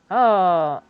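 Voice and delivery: normal talking voice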